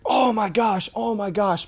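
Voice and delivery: high, loud gushing oh my gosh; mock over-the-top concern; big fuss. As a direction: make a huge fuss over it. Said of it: "made a big deal about it".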